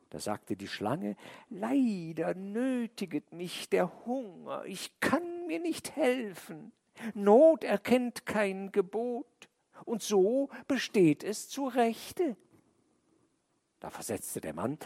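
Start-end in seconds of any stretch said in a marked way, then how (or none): 1.5-12.4 high, slow snake voice with an odd timbre; drawn-out serpent excuse; sly snake voice. A narrator's reading. The snake pleads hunger to the man, high and slow.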